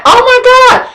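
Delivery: high-pitched retold shock; startled reaction. Driver as someone jumps in front of the car, startled.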